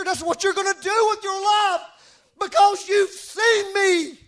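high, loud, rousing proclamation to Peter; full-force preaching. Acted.

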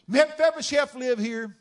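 loud, high call at the tent; commanding shouted question; herald's shout. Acted. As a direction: bellow it like a royal messenger. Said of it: "an entourage come right up to the tent and say".

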